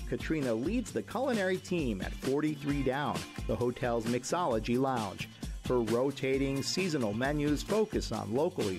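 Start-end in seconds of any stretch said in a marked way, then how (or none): none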